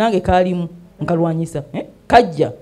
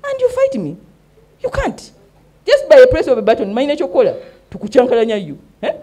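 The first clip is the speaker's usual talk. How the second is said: retold scolding; 5 semitones higher, 5 dB louder than usual. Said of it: "ne ngamba".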